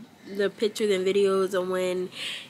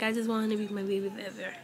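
usual speaking voice

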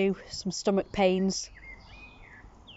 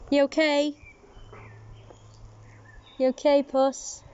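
cat talk; 5 semitones higher than usual. Real, not acted. Cooing at a cat.

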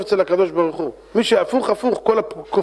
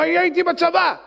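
higher, excited driver voice; eager taxi driver; 10 semitones higher than usual. Acted. Driver eagerly tells the rabbi his army story, higher voice. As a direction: blurt it out like a keen cabbie.

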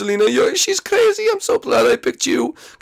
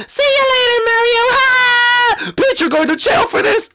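gleeful escape shout; 11 semitones higher, 4 dB louder than usual. Acted. Peach fleeing, Mario yelling after.

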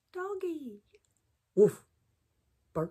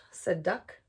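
ordinary delivery

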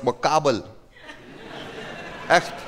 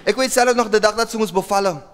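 ordinary talk

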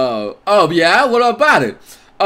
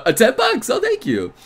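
ordinary delivery

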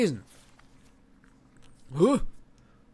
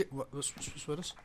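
startled whisper; 5 semitones lower, 17 dB quieter than usual. Real, not acted. Spooked by noise in dark.

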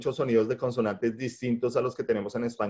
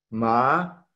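MA stretched and gliding upward; second tone, a long rising MA. Shown as a demonstration. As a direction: let MA climb like a question. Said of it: "con el tono ascendente o segundo tono".

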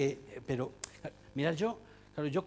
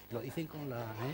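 ordinary delivery

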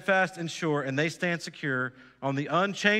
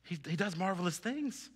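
hushed awe; 8 dB quieter than usual. Acted. Hushed after preaching climax.

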